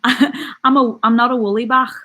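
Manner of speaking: put-on Liverpool accent; Scouse accent. Shown as a demonstration. Streamer showing viewers her Scouse, Scouse accent. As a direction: say it in playful Scouse. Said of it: "that's more of a Scouse vibe".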